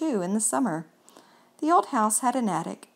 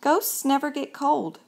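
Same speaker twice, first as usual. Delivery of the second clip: ordinary delivery, nothing marked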